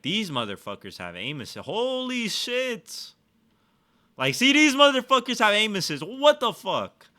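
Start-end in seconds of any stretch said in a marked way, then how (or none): 1.7-3.1 high, slow drawn-out amazement; stunned. Real, not acted. Amazed at enemy aim. 4.2-7.1 high, loud indignant complaint; loud rant. Real, not acted. Ranting at enemy aim assist.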